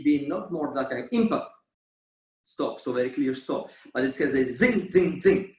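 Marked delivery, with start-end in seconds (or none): 4.4-5.5 percussive accents. Shown as a demonstration. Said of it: "very percussive".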